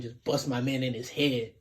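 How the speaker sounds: usual speaking voice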